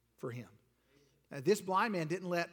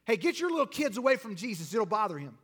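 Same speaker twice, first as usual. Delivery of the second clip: raised-voice shooing away; officious rebuke; 3 semitones higher, 4 dB louder than usual. Acted. Disciples sending children away from Jesus, scolding. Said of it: "probably disciples, because they were known".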